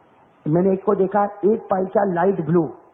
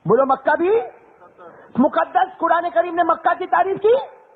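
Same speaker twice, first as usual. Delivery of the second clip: fiery preaching; 9 semitones higher than usual. Acted. Preacher rousing the congregation about the holiest place, shouting. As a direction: rouse the crowd with full force.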